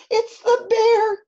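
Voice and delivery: put-on high, loud child's alarm; high-pitched, urgent storybook wail; frightened cry. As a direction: cry it out like a panicking little boy. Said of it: "cried Eddie".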